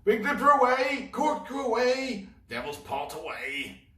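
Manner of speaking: high, loud pirate shouts; shouting. Acted. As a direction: yell it up to the captain. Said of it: "shouts of the other pirates".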